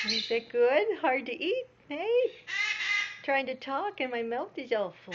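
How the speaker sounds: loud, playful pet voice; voicing the pet. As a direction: speak for the bird with a full beak. Speaking for the pet while it eats.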